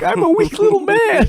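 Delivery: very high, mock-pitiful squeak; self-mocking whimper. Mocking himself for crying at Avengers, high pitiful squeak. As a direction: squeak it like a tiny pitiful man. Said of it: "and I'm like".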